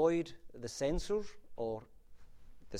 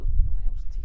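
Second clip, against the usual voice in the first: real startled breathy outburst when tripping on a wire; sudden loud breathy curse of surprise; 17 dB louder than usual. Real, not acted. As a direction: blurt it out, caught off guard by the cable. Trips over the mic wire.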